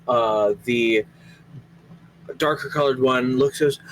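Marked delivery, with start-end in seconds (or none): none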